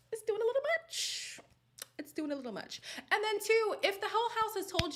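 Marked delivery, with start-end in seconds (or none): 0.1-1.4 mock high voice. Mocking Tasha's excess.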